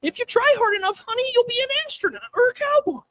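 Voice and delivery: put-on high-pitched sugary old-lady voice; sweet decrepit grandma. Doting grandma encouraging her grandchild, sweet and high-pitched. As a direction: coo it like a frail, doting granny. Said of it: "grandma that's all sweet and decrepit".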